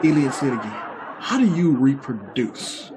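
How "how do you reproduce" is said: drawn-out, deliberate question; slow repeated question. Acted. Alien asking a startled man again, slowly and deliberately. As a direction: stretch out each word as if to be understood.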